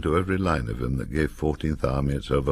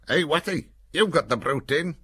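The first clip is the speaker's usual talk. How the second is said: much higher Cumbrian voice, calling; calling to a mate; 12 semitones higher than usual. A narrator's reading. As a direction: nudge Watty about the brew tin. Soldier calling to Watty.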